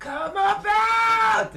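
long, high drawn-out call; hammed-up movie shout. Retelling Titanic's rescue scene, high drawn-out call. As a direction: stretch it out, high and slow. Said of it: "And then he goes".

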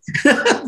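short high laugh; a quick giggle at his own joke. Real, not acted.

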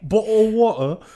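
hammed-up British accent on "Bottle of water", with dropped t's, laughing; mock British accent. Partner joins the British-accent joke, laughing, in a hammed-up British accent. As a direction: play-act a Brit asking for water. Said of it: "I love British accents too".

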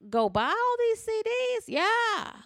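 high, slow mock-innocent asking; incredulous church-meeting question; retold question. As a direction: play dumb with a sweet high question. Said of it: "I said".

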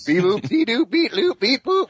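high beeping droid noises; R2-D2 beeps. Retold or imitated. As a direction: beep and boop like a droid. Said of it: "R two D two is gonna be like".

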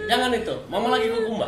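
scolding mother. Pretend. Mock mother voice.

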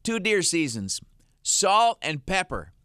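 high, slow, deadpan delivery; dad's punchline. Retold or imitated. Father delivers the salt and pepper joke to his son. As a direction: land the joke like a proud dad. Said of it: "And he goes".

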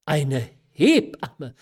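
loud, drawn-out outraged exclamation; indignant cry. A narrator's reading. Mother echoing her daughter's request for a midwife, indignant cry. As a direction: repeat it back in loud, slow indignation. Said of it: "rief Frau von G. mit Entwürdigung".

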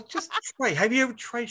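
mock wife urging him to try; wife's coaxing. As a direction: coax him like a tired wife. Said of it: "She goes".

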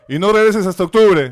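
loud shout of a fed-up teacher; angry teacher shout. Retold or imitated. Teacher banishing Rondón until October, loud angry shout. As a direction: yell the punishment at the pupil. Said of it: "me dice".

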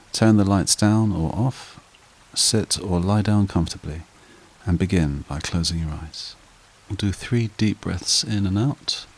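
slow, calm meditation guide voice; guided meditation. Acted. Meditation host guiding listeners to relax, calm and unhurried. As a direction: ease the listener down into stillness.

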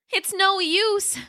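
high, slow, a touch of sad little T-Rex; Roxy despairing. Acted. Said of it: "she said finally".

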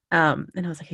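normal talking voice

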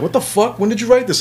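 high, loud amazed outburst; astonished, voice jumping high; shocked girlfriend. Retold or imitated.

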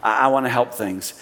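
a lightly voiced wish to help; quiet servant tone; gentle serving tone. Shown as a demonstration. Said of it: "tone that says".

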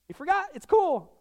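high, light mock-casual Jesus; teasing Jesus. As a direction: tease him gently in a lifted voice. Preacher voicing Jesus, teasing.

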